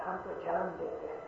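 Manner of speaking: ہم تو جان دیتے ہیں said softly, almost to himself; soft first take. Real, not acted. Preacher musing quietly to the congregation, softly. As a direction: drop the voice on جان.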